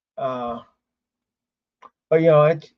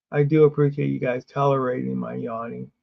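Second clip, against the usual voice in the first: ordinary talk, nothing marked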